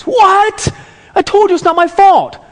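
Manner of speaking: very high, loud mock teen yelp; outraged disbelief. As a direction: protest like a kid unjustly punished. Son outraged at losing keys.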